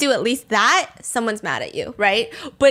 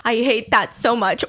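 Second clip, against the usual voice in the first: normal talking voice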